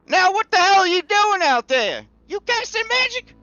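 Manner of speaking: high, loud woman's role voice, demanding answers; high, loud scolding character voice; Forrester Dunsmith. Acted. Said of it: "runs to the window and she says".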